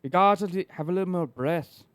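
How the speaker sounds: breathy-tongued Irish accent. Shown as a demonstration. Showing the host how Irish works, Irish accent.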